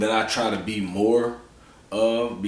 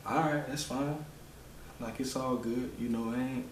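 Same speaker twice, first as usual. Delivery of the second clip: soft, higher soothing voice; retold fatherly comforting, kept hushed; gentle reassurance; 4 semitones higher, 12 dB quieter than usual. Father calming his upset daughter.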